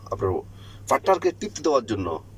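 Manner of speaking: ordinary talk, nothing marked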